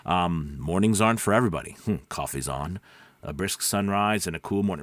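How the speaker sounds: low-pitched morning coffee commercial. Shown as a demonstration. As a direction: drop deep and easy for a coffee ad. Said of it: "When I'm doing a commercial".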